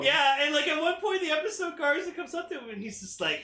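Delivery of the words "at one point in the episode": ordinary delivery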